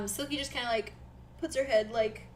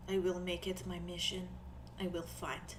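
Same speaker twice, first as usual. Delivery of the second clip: put-on softer, accented character voice; Nori, quietly resolved; 3 semitones lower, 12 dB quieter than usual. Nori promises dragon man the gold, quiet resolve. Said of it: "nods slowly and she's like".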